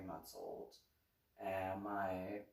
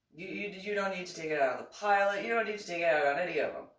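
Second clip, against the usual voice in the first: fast rant; 8 semitones higher, 8 dB louder, much faster than usual. Real, not acted. Ranting at upset passengers.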